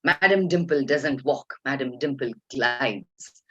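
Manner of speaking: slow, stately correction; imitating grand actress. Star correcting young assistant director, imitated imperious manner. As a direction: draw it out like a haughty diva. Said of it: "she looked at the A D M and she said".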